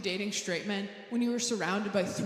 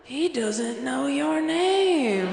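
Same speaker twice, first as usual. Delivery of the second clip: loud, very drawn-out damning verdict; slow, booming mock exposé; mock accusation; 3 semitones higher, 9 dB louder, much slower than usual. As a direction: stretch it out loudly like a terrible revelation. Escalating mock cheating-article verdict.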